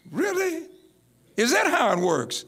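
high, questioning doubt; sceptical disbelief. Real, not acted. Preacher answering those boasting of the Holy Ghost, sceptical.

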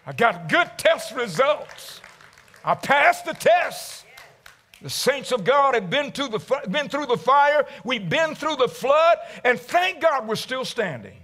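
sermon climbing to a triumphant shout; building preacher's cry. Acted. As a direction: lift the congregation, rising to a call. Preaching builds to full force.

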